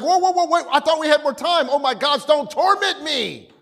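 high mock alarm; comic high-pitched panic; panicked demons. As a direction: blurt a hammed-up plea, stretching the end. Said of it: "they're like".

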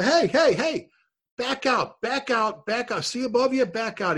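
high-pitched call at contained volume; retold shout, repeated as short commands; urgent warning call. Chief warning firefighters to back out, an urgent mock call.

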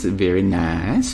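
drawn-out, slow "Very nice". Pretend. Answering a chat fan of Aussie accents, slow and drawn out. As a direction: stretch "Very nice" out slowly, savouring it.

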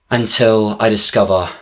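ordinary delivery

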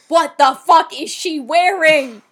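loud, slow, high-pitched disbelief; appalled outburst. Acted. Podcaster acting out a viewer seeing the outfit, appalled. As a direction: hammer it out, utterly scandalized. Acted outraged reaction to outfit.

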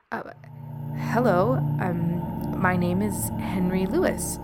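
nervous halting stammer. Acted. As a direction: stumble nervously over the words. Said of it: "Henry stammered".

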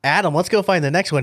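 rally your buddy, all fired up; retold eager urging. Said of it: "I was all fired up".

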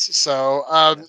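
ordinary talk, nothing marked

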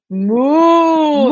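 sing-song mooing cow sound. Shown as a demonstration. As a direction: moo like a toy cow to make a small child laugh. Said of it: "puede sacar la vaquita y decir".

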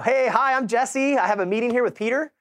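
high, bright-eyed hello to strangers; eager greeting. Retold or imitated. Agent greeting the hedge fund office, eager and high. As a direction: pitch up like an eager newcomer.

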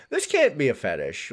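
usual speaking voice

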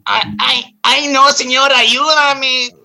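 very high, loud cartoon Mexican accent; bad Speedy Gonzalez accent. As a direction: wail in a hammed-up Mexican accent. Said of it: "I thought that was Speedy Gonzalez".

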